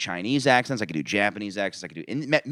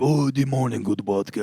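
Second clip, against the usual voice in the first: over-the-top Japanese accent; 2 semitones higher than usual. Shown as a demonstration. Showing friends a bad Japanese accent, hammed up. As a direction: lay the Japanese accent on thick as a joke. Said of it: "Japanese one's very ... over the top".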